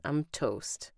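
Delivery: plain reading voice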